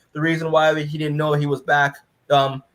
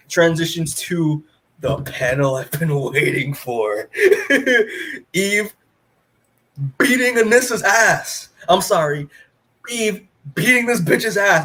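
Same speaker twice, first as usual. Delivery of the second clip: gleeful laughing; 4 semitones higher than usual. Real, not acted. Gleeful about the fight panel.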